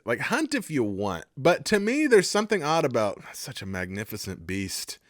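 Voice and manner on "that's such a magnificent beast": low, soft admiring hunter; hushed mock awe. Hunter admiring the animal before shooting it, hushed awe. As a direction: murmur it low in pretend reverence.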